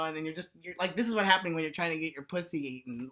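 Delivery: normal talking voice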